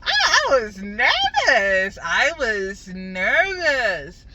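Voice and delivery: loud, very drawn-out comic wail; hammed-up nervousness. Vlogger admitting fear of the angry man, comically wailed. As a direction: stretch each word, swooping then falling. Hammed-up confession of past nerves.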